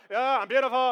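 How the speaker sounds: imitating a daughter's flat, going-through-the-motions reply; bored routine. Dad mimicking daughter reciting her car affirmation, bored. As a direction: toss it off like a chore. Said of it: "She almost says it like".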